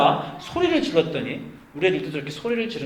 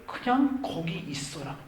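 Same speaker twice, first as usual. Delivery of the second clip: soft, slow voice of God; calm divine reply; 8 dB quieter, slower than usual. Acted. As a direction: answer the frantic complaint with quiet command. God calmly answers Abraham's complaint.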